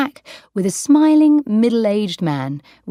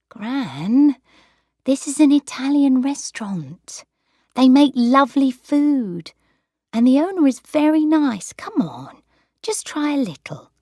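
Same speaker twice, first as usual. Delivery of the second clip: lightly voiced girl coaxing, higher; coaxing granddaughter; 9 semitones higher than usual. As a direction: gently coax the stubborn grandmother.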